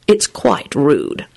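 slow, haughty verdict; lofty. A narrator's reading. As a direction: draw it out with disdain. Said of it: "Lupin replied loftily".

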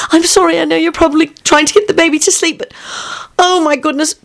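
put-on high, breathless worried girl; anxious girl. Rosie telling the professor of trouble, high and worried. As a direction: rush out the bad news in a fret.

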